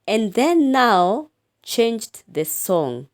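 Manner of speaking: slow, lifted recitation; expressive poem reading. Shown as a demonstration. Teacher reciting the poem line for pupils, slow and lifted. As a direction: read it up and out, slowly. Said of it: "line two".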